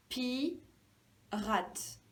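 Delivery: "pirate" split as pi, rate; syllable by syllable. Shown as a demonstration. Teacher finding the first syllable, syllable by syllable. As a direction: say "pirate" with a gap after pi. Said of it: "La première syllabe".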